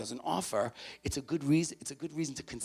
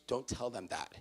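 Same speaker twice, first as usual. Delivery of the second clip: usual speaking voice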